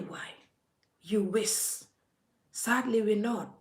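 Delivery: ordinary delivery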